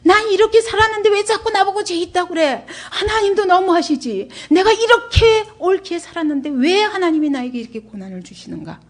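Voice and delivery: acted-out indignant complaint, high and pleading at first; resentful. Job protests his suffering under God, resentful. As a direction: protest the injustice, falling away at the end. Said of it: "원망이 깔려 있는 거예요".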